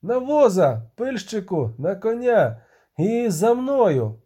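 rally them with urgent orders; shouting orders. A narrator's reading. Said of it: "крикнув Бугольд". (вигукуючи накази)

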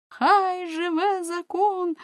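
high, drawn-out acclaiming cry; crowd's cheer. A narrator's reading. People hailing the judge's ruling, cheering. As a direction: call it out high and long like a crowd. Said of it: "гукнув народ".

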